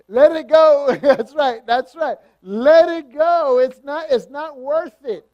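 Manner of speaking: high, loud and slow let it go; loud preaching. Acted. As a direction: shout it to the congregation. Preacher shouting refrain.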